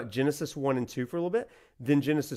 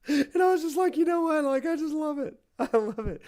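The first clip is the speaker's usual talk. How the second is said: high, squeaky voice through laughter; real giggling fit while talking; helpless laughter; 12 semitones higher than usual. Real, not acted. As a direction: laugh so hard the words go high. Laughing at own bad old video.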